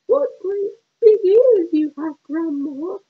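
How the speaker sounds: put-on high, wide-eyed little girl. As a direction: squeak it like an innocent child. Girl questions disguised wolf.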